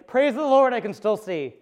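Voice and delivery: high, loud mock rejoicing; mock praise. Pastor acting out waking up grateful, high mock praise. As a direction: burst out thanking God, hammed up.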